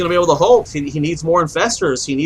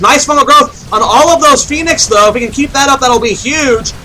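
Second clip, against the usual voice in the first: excited; 6 semitones higher, 4 dB louder than usual. Real, not acted. Big play excites caster.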